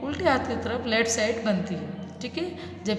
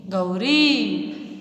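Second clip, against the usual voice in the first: slow drawn-out reading; 3 semitones lower, much slower than usual. Shown as a demonstration. Teacher slowly sounding out the word for children. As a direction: stretch Gauri very slowly with a long औ. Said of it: "repeated reading".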